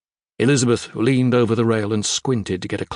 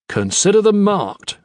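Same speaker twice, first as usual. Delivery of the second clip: put-on higher, louder British accent, crisp dismissal; British officer brushing off superstition; British accent, dry and curt; clipped British accent; 9 semitones higher, 5 dB louder than usual. A narrator's reading. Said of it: "in his clipped British accent".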